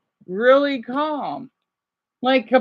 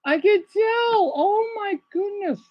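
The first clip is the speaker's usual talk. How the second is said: mock amazement; 11 semitones higher than usual. Real, not acted. Mock reaction to companion.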